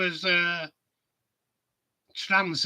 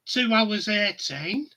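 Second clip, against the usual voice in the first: usual speaking voice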